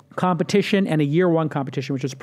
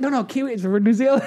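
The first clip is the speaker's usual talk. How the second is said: mock correction; 7 semitones higher than usual. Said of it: "And they're like".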